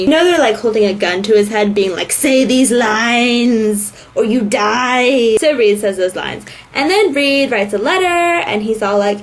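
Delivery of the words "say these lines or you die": very slow, drawn-out threat; hammed-up villain stretching every word; mock menacing captor. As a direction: imitate a gunman dictating orders, slowly. Voicing captors holding a gun.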